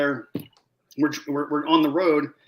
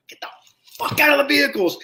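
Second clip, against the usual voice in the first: furious yell, pitched way up and louder; yelling at his men; 11 semitones higher, 4 dB louder, faster than usual. Retold or imitated. Gunny yelling at his snipers sitting in the van. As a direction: bark the order in a rage. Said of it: "I about lost my mind".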